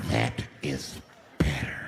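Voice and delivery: very low, drawn-out satisfaction; menacing approval. Acted. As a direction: drop way down and space out the words. Offender repeats it, crowd quiet.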